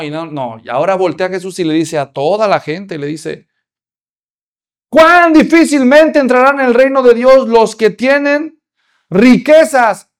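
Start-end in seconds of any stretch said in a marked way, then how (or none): 4.9-10.1 raised, forceful declaration to the whole crowd; loud rebuke. Acted. Preacher voicing Jesus to the crowd, loud rebuke. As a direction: thunder it out as Jesus confronting everyone.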